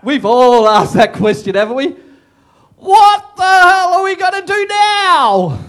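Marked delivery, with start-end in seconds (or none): 2.8-5.6 high, loud, slow despairing cry; loud mock panic; exasperated panic. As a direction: throw up your hands in dismay. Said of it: "You can hear it".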